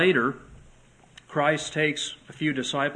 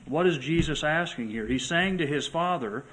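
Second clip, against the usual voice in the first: normal talking voice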